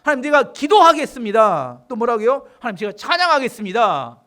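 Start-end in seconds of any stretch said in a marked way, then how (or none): 0.0-1.8 loud declaration, pitched higher; determined vow. Acted. Preacher voices David resolving to pray, determined vow. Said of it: "이런 결단하는 겁니다". 2.6-4.2 loud, firm declaration; determined vow. Acted. Preacher voices David resolving to praise, determined vow. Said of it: "이런 결단하는 겁니다".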